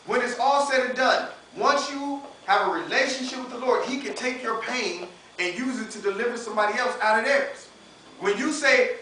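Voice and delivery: usual speaking voice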